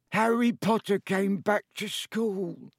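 high, slow elf voice, only mildly miserable; a touch of misery in a squeaky house elf; miserably. Acted. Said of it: "he whispered miserably".

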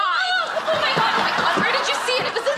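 high-pitched frightened outburst; panicked squeal. Acted. Woman hearing of a huge mouse, panicked squeal. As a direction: squeal in horror.

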